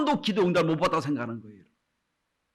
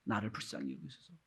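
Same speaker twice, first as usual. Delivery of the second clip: humble prayer; 6 semitones lower, 13 dB quieter than usual. Retold or imitated. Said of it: "세리가 뭐라고 기도했지요?".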